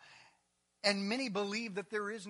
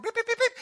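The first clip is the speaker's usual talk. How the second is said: high, loud mimicked dial beeps; phone beeps; 15 dB louder, faster than usual. Preacher miming dialling the president, high mock beeps. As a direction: squeak out the dialling tones. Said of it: "Give me a phone".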